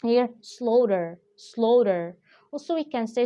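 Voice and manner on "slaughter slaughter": "slaughter" with the final r sounded; American pronunciation. Shown as a demonstration. English teacher introducing a vocabulary word, "slaughter" American-style with final r. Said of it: "it will be an American pronunciation".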